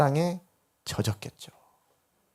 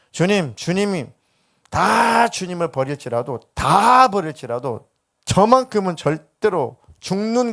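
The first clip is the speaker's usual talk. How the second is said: impassioned pledge, high and loud; 열렬한 맹세; 5 semitones higher, 4 dB louder than usual. Acted. 베드로의 충성 맹세를 연기. (목소리 높여 간절히 맹세하듯)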